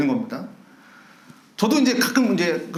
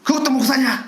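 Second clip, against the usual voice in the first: higher, scornful jab; indignant jeer; 7 semitones higher than usual. Retold or imitated. Church member mocks the pastor to his wife, a scornful higher jeer. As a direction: sneer it at the so-called pastor. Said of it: "막 이렇게 나오는 거죠".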